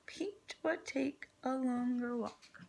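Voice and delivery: usual speaking voice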